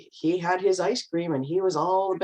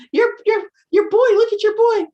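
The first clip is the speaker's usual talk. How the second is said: very high, loud imitation of fretting mothers; high mothers' voices; 8 dB louder than usual. Mothers at the Little League game, very high loud mock alarm. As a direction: squeal it up high like the panicking moms. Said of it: "the voices were really high".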